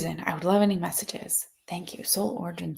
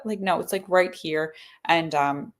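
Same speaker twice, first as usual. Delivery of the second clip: usual speaking voice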